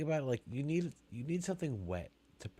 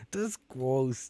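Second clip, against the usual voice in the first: ordinary delivery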